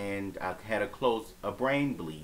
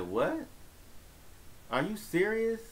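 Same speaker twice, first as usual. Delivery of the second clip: retelling a shocked, half-awake question; stunned disbelief; 11 semitones higher than usual. Retold or imitated. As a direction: pitch leaps way up on serious. Waking in hospital, hearing bad news.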